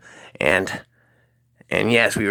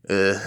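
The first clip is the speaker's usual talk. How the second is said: staccato, chuckling cartoon laugh; long stuttering "uh-huh-huh" laugh; crazy Butthead laugh. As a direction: imitate Butthead's dopey machine-gun chuckle. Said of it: "Matt started laughing".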